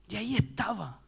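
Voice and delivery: normal talking voice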